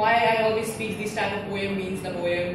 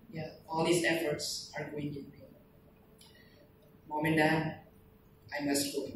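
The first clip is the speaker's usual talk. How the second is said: resigned farewell recitation; 2 semitones lower, 3 dB quieter than usual. Acted. Dying soldier ending his last letter, softly resigned. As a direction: let each line fade away with pauses.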